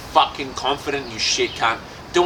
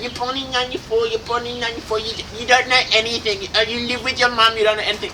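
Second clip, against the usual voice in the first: mocking a critic; 5 semitones higher than usual. S0 imitating a critic who calls him too young, sneering higher voice. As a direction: mimic the condescending guy, a bit higher and hushed.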